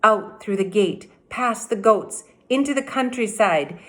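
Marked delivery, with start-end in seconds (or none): none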